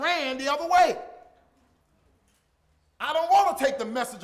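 loud, driving sermon delivery; preaching at full force. Acted. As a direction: preach it hard to the congregation.

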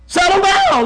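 high-pitched preacher's command to the winds. Acted. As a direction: order the storm to behave, pitched high. Voices Jesus ordering the winds.

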